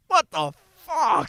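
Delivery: high, slow stunned "what the fuck"; replaying the shock of opening the mail; mock disbelief. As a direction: drag it out in disbelief. Shocked at pile of tickets.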